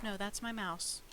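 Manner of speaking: usual speaking voice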